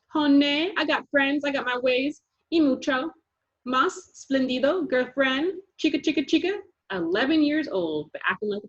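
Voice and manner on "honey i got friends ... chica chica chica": put-on high, louder sassy girl voice; sassy girl bragging to friends; sassy show-off. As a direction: brag with attitude. Thule showing off.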